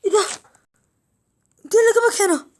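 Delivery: loud, high startled yell; shocked shout. Acted. Boy confronting the intruder, shouting in shock.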